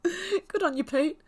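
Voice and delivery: high laughing praise. Real, not acted. Streamer praises a viewer while laughing. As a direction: laugh out the words warmly.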